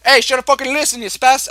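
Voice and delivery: loud, high mock Italian-American accent; attempted Italian-American accent. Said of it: "I can't do a wap voice".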